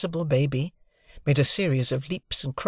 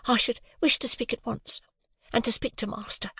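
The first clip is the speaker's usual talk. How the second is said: polite housemaid; 10 semitones higher than usual. A narrator's reading. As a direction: apologise but hold firm. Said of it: "returned the housemaid".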